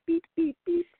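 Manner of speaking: high, playful beep noises; high mock honks for a nose pinch; imitated beeping. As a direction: beep like a squeezed horn.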